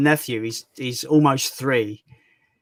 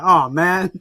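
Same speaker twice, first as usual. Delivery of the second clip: mock upset.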